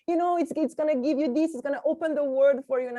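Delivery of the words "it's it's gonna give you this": normal talking voice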